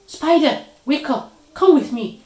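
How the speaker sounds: high, loud wake-up call; urgent calling. A narrator's reading. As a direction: rouse a sleeper through the door. Deer banging on Spider's door.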